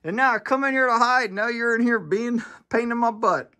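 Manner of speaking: the cat griping at his owner; voicing the cat. Cat complaining his owner chased him from the garage, high whiny voice. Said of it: "It's like".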